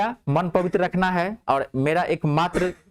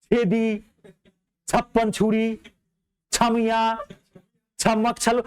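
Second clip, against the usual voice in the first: gleeful; 6 semitones higher, slower than usual. Retold or imitated. Lists cheeky names with glee.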